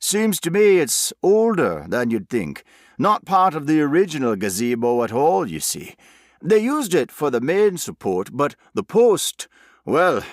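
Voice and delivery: broad West Country workman; thick country accent. A narrator's reading. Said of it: "his country accent as thick as homemade jam".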